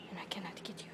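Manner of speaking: soft unvoiced whisper. Real, not acted. Whispering to the bird she cannot reach. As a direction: let it fade to a whisper. Talking softly to hidden bird.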